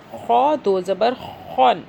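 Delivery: خو and خون with a heavy scraped KH; slow, full KH from the throat in خو; thick kha. Shown as a demonstration. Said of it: "خو کو موٹا کریں گے". (خو موٹا، حلق سے)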